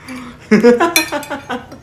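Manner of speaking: high, delighted laugh; gleeful laugh. Real, not acted. Vlogger tickled by her own love of diamonds, gleeful laugh. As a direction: burst out in a high giggle.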